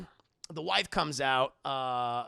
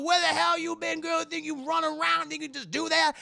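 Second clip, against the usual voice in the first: much higher, louder caricatured voice; mock master yell; 12 semitones higher, 5 dB louder than usual. Master grabs Patsy on the road and yells, mock. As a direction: screech at her like a crazed master.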